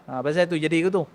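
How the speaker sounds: ordinary delivery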